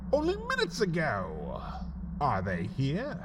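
plain reading voice

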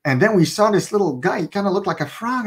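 ordinary talk, nothing marked

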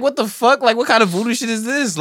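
high-pitched disbelief; mock bewildered outburst. Viewer reacting to the paint slash, high incredulous retelling.

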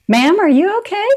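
high, loud imitation of worried staff; concerned staff. Dental staff checking on a wandering patient, mock concerned. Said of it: "they kept checking on me like".

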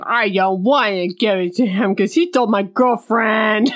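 mock sulky whine; pouty sourpuss. Jilted lover refusing to help his rival, pouty sulk. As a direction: whine it like a pouting little boy. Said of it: "one little pouty sourpuss in the corner".

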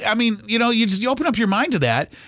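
ordinary talk, nothing marked

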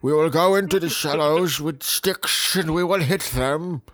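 high, loud mock oration; rally troops as a British statesman; hammed-up wartime speech; Winston Churchill. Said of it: "Winston Churchill".

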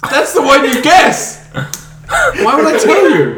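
high, incredulous outburst; amused, high-pitched exasperation; exasperated protest. Real, not acted. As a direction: protest as if they've missed the whole point. Exasperated game host protests to players.

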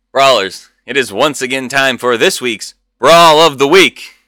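loud ringside announcer call. Acted. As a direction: announce it like a fight card. Announcing the weekly brawl.

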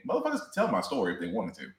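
low, quick, quiet mock Mace Windu; laid-back Mace quote. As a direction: toss it off like a cool, unbothered Jedi. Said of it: "chilling out and saying".

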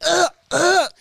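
retold gagging noises, high-pitched; choking sounds on swallowed gum; imitating choking. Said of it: "I started choking".

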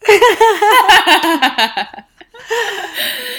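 long, high, loud burst of laughter. Real, not acted. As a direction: crack up helplessly at your own slip. Laughing at own mispronunciation.

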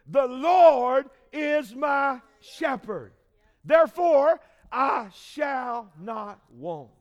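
slow, loud psalm proclamation; preaching. Acted. Preacher quoting the twenty-third Psalm, slow and loud. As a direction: proclaim each word slowly.

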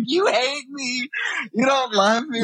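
high, slow, hammed-up wounded pleading; mock hurt whine. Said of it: "I took it personally".

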